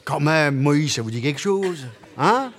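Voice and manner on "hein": teasing prod. Real, not acted. Prodding the audience.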